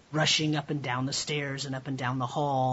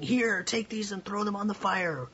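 higher-pitched call to hurried helpers; urgent bucket carrier; 7 semitones higher than usual. A narrator's reading. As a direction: call out as if handing over buckets in a fire. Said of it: "and they're saying".

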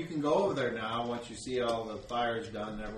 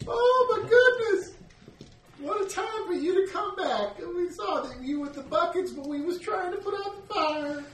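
put-on overjoyed old mother; 4 dB louder than usual. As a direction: greet her long-lost boy. Mother greeting her son.

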